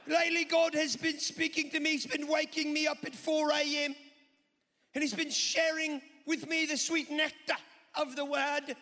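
loud, high Australian preacher impression; Christine Caine. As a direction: proclaim it like a piratical Australian preacher. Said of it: "she sounds like a pirate".

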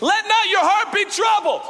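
a loud proclamation of the verse; proclaiming loudly. Retold or imitated. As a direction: call the verse out loud and high. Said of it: "Jesus says".

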